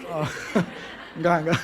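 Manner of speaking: usual speaking voice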